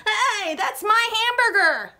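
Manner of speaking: put-on high, loud angry boy's shout; high indignant yell. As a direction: shout it like a boy whose burger got taken. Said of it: "A boy shouted".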